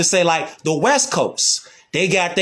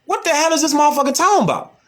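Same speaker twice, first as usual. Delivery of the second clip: high, fast, bewildered retort; tossing off disbelief at nonsense; baffled outburst; 9 semitones higher, much faster than usual. Retold or imitated. Retelling confusion at a Memphis guy's slang, high and fast. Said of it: "I was like".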